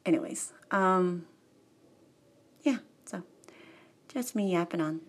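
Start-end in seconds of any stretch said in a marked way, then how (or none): none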